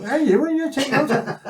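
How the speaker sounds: high, loud mock girl's voice; lightly laughing high imitation of the girlfriend. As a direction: put on a shrill-free high female squeal, laughing. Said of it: "she was going".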